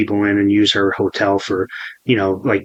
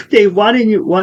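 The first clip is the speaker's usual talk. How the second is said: imitated scolding mother; 8 semitones higher than usual. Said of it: "That's what my mother said".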